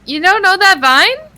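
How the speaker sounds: high, loud disbelief; can't believe you missed it; surprised. Real, not acted. Shocked friend doesn't know vine.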